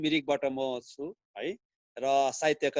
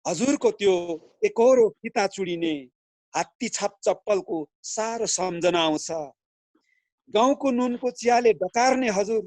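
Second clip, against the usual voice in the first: higher, louder than chat, in phrases with pauses; poem recital; 6 semitones higher, 6 dB louder than usual. Acted. Villager Kale addresses a forgetful leader, reciting a poem. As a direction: recite verse with swelling phrases, dropping on the refrains.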